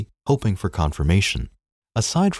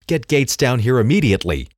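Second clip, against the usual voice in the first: angry, louder and higher command; fuming; 6 semitones higher, 4 dB louder than usual. Acted. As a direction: snap the order out in fury. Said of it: "he fumed".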